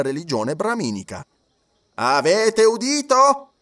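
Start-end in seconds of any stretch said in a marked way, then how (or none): 1.9-3.5 high, slow, louder judge's question; judge asking. A narrator's reading. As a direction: press the accused from the bench. (giudice che interroga, acuto e lento)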